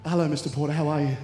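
low, smooth put-on manager voice; imitated phone manager. Manager picks up held call, imitated low greeting. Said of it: "this voice picks up".

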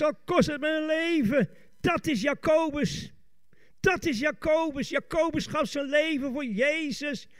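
high-pitched, fired-up proclamation; fervent preaching. Real, not acted. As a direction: preach devotion at full force. Preaches at full force. (vurig prekend)

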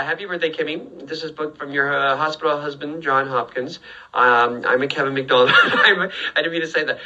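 usual speaking voice

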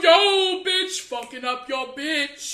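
mock gangster pose, shouted. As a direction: throw the peace sign and play tough.

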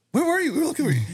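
very high worried buddy voice; high mock worried friend; buddy's voice. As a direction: pitch way up, frantic friend.

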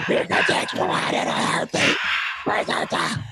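whispered ad tagline; hushed dramatic ad voice; energy drink ad. Acted. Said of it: "was that Macho Man or was that Hulk".

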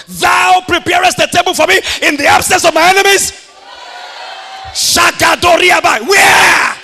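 fiery sermon shout; full-force preaching. Real, not acted. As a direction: declare it to the crowd.

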